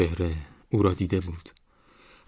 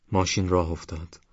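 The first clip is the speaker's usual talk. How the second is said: plain reading voice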